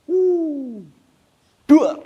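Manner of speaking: high whooping mock cry of a falling child; a hammed-up whoosh of a fall. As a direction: yelp it high as the kid drops. Mimes child falling from seventh floor.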